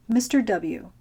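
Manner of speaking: ordinary delivery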